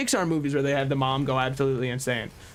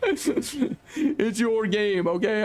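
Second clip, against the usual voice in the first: laughing; 6 semitones higher, much slower than usual. Real, not acted. Laughing apology during banter.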